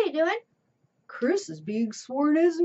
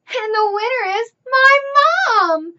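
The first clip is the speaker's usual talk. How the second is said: high, loud, thrilled announcement; excited; 10 semitones higher, 13 dB louder than usual. Acted. Child announcing mom won, thrilled and loud. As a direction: burst out with the big news.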